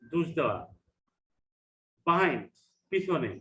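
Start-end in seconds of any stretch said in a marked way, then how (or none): none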